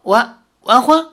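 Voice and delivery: stammered question, pitched well up; stunned. A narrator's reading. Young man startled by sudden talk of marriage, stunned. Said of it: "李逍遥一愣".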